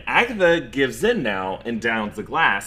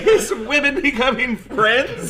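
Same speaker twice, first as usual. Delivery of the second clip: high, loud hammed-up pundit voice; mock alarmist announcer; 11 semitones higher, 8 dB louder than usual. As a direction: sound like a panicked TV host.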